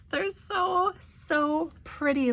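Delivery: can't contain the joy over the flowers; squealing delight. Real, not acted. Gushing to viewers over gift flowers, high squeal.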